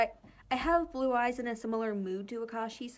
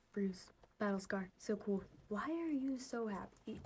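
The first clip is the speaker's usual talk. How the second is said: whisper; 7 dB quieter than usual. Real, not acted. Whispering at the clip.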